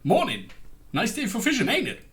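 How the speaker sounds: chirpy game villager with a high, swooping finish; NPC catchphrase. Acted. Imitating an NPC who only has one phrase, sing-song greeting. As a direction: swing the pitch up on the tail question. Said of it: "he only has one phrase".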